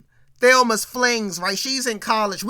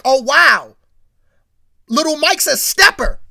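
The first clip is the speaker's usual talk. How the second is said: impressed exclamation; 4 semitones higher, 12 dB louder than usual. Retold or imitated. Viewer reacting to Mike's jacket.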